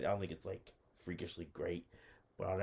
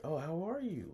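high, friendly small-talk voice; high-voiced hostess making conversation; imitated woman; 10 semitones higher, 4 dB louder than usual. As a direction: mimic her asking with the pitch raised. Voices her asking questions.

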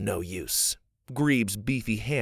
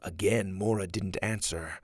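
plain reading voice

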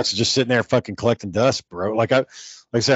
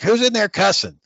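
higher scolding aunt voice; aunt in background; 10 semitones higher than usual. Retold or imitated. Aunt in the background scolding the cussing kid, higher voice. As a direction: call out like an aunt from another room. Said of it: "their aunt would be in the background".

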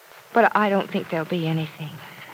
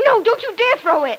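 high, loud warning yelp; playful alarm; 13 semitones higher, 6 dB louder than usual. Acted. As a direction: cry out in sudden alarm to stop a throw. He threatens to toss kitchen item.

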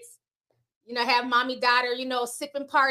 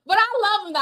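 laughing; 7 semitones higher, 4 dB louder, much faster than usual. Real, not acted. Laughing after a potty story.

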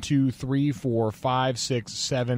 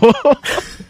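short high laugh; amused laugh; 7 semitones higher, 5 dB louder than usual. Real, not acted. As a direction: burst out laughing. Laughs at his nickname.

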